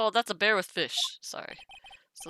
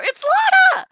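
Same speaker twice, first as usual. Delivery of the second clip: excited shriek; 18 dB louder than usual. Real, not acted. Player shrieks recognising the character.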